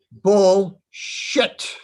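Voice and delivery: mock bark straight into the face; captain's retort. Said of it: "put his mouth in my face and said".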